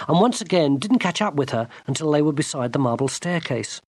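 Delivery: ordinary delivery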